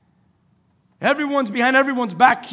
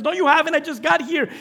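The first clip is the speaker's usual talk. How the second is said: high, insistent retold denial; puzzled protest; 8 semitones higher, faster than usual. Preacher voices Esau correcting his father, puzzled protest. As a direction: act out a son's baffled objection.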